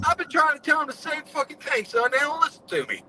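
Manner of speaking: a hint of a gruff old car builder venting to a young mechanic; lightly voiced exasperated mentor; imitating Carol Shelby. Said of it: "He goes".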